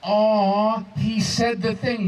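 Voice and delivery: drawn-out pitying aw, slow; mock sympathetic aw. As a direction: coo it like a pitying crowd. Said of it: "Oz just tells me".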